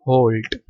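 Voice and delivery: "halt" with a rounded O, like "holt". Shown as a demonstration. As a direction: say "halt" to rhyme with "bolt". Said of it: "pronounce कैसे होगी?".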